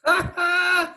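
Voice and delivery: sudden high-pitched laugh; a shrieking laugh bursts out; high burst of laughter. Real, not acted. Bursts out at the story.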